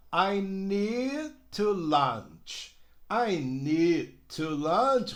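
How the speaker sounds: slow, the D in need not joined to to; careful slow I need to lunch; no linking. Shown as a demonstration. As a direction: keep need and to separate. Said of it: "não deu pra emendar".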